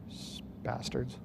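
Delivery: under-the-breath whisper; whispered curse. Real, not acted. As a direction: spit it out in a whisper. Muttered curse at attackers.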